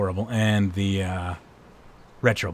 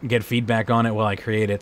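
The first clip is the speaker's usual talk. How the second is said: ordinary delivery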